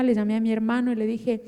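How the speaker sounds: normal talking voice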